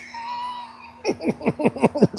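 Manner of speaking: loud squealing laughter, breaking up; high shrieking laugh. Real, not acted. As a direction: crack up completely.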